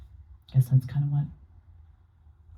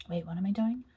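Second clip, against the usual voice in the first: ordinary talk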